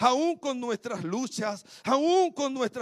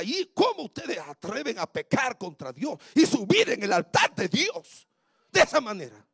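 higher, louder scolding that keeps building; stern rebuke; 4 semitones higher, 6 dB louder than usual. Retold or imitated. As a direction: scold them hard, rising to an outraged cry. Retold stern rebuke in counseling.

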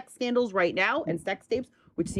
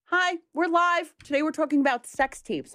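excited; 11 semitones higher than usual. Real, not acted. Greeting live audience.

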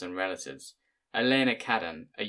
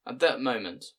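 usual speaking voice

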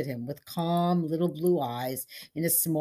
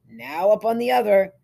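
ordinary delivery, nothing marked

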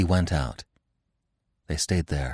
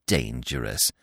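low, softer falling echo; superior, dismissive; 7 semitones lower, 5 dB quieter than usual. A narrator's reading. Gobo dismissing the others' warning, smug and low. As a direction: drop the word dismissively, smugly. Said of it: "smiling in his superior way".

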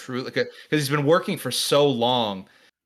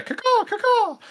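high, loud bird screech; shrill screeching bird caw; 5 dB louder than usual. Pretend. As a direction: screech out a piercing bird call. Finch head joke, bird calls.

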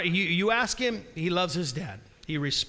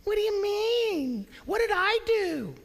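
put-on whiny kid protest; 13 semitones higher than usual. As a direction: whine like a kid caught out. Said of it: "have you ever heard that from your kids".

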